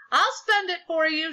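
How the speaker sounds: high, loud call-out; shouted. A narrator's reading. Librarian offers to spend the money, shouted. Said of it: "shouted our libra".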